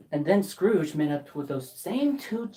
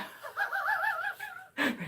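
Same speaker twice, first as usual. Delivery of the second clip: high giddy laugh; 8 dB quieter than usual. Acted.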